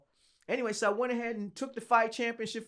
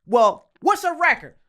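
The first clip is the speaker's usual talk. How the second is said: slow, loud, high challenge; mock motherly challenge; imitated tough mom; 6 semitones higher, 10 dB louder than usual. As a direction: draw it out loudly like an unimpressed mother.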